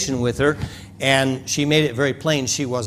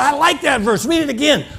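woman's voice; 12 semitones higher than usual. Retold or imitated.

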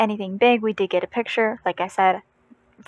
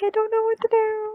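much higher, strained helpless wail; flustered aunt holding the puke bucket, high mock wail; helpless panic; 13 semitones higher than usual. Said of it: "I'm just sitting there like".

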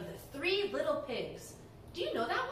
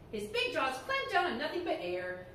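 ordinary delivery

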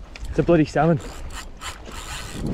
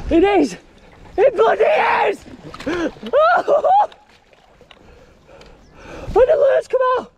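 ecstatic shouting. Real, not acted. Angler whooping to his viewers over a landed salmon, ecstatic shout. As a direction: whoop it out like you've just won. Lands a salmon after long effort.

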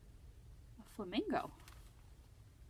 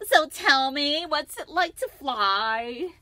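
put-on slow, high, louder questioning girl voice; Lucy curious; 5 semitones higher, 12 dB louder, much slower than usual. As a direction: draw out the question eagerly. Lucy asking the ostrich.

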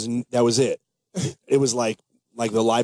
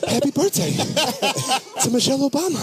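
much higher, laughing Boston tough-guy impression; Mark Wahlberg impression; 11 semitones higher than usual. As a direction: greet them like a pumped-up Boston kid. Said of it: "Mark Wahlberg".

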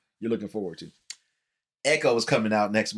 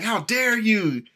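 mock outraged fan; 10 semitones higher, 4 dB louder, much slower than usual. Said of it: "They was like".

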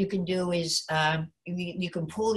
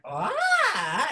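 high open "ah"; stretched-up high voice; 9 semitones higher than usual. Shown as a demonstration. Teacher showing a warmed-up voice, high sustained sound. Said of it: "the voice is already stretched up there".

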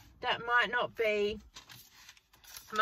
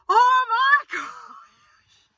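high, loud, drawn-out mock squeal; long squealed impression of astonishment; amazed reaction; 8 dB louder, much slower than usual. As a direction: imitate a caller bowled over with delight. Retells caller's amazed reaction.

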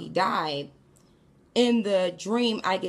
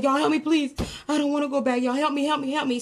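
higher, urgent begging; imitated scared plea; 6 semitones higher, faster than usual. Scared boy begging the group for help, frantic plea. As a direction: plead in a run, acting out panic. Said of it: "he was so scared in the dream".

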